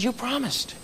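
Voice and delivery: put-on a child quietly reminding his dad; hurt little boy. Son reminding dad of promise, soft hurt kid voice.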